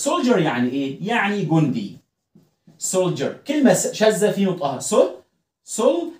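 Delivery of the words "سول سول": "سول" alone as "sol", high pitch; syllable demo. Shown as a demonstration. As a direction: isolate "سول" as one syllable. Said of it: "ال ال هتنطق صح".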